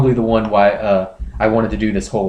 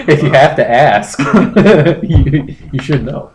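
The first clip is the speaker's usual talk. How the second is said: louder, laughing through the words; 6 semitones higher, 7 dB louder than usual. Real, not acted. Laughing at the cohost's forum story, loud and amused. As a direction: crack up while giving the answer. Laughing reply to absurd question.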